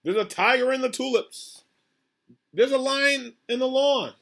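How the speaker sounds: high-pitched, lilting list of surprises; animated storybook narration. A narrator's reading. Reader showing children animals all over town, animated storybook narration. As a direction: build wonder with each animal found. Reads picture book aloud.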